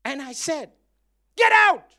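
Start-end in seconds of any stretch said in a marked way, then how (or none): 1.3-1.8 sharp, high, loud command; loud rebuking yell at a fly; commanding shout. Retold or imitated. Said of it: "the way I spoke to the fly".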